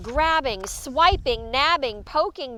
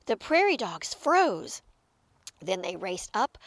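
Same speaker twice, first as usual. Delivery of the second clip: usual speaking voice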